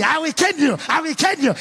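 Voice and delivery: imitating angry father. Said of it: "that is how my father came me".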